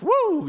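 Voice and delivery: high-pitched "woo" of delight; excited whoop. Acted. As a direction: let out a thrilled whoop. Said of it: "that's a rousing ending".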